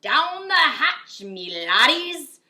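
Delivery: loud, slow, high booming toast; hammed-up pirate roar; pirate yell. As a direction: bellow it, fist pounding the table. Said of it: "pounded his fist on the table and yelled".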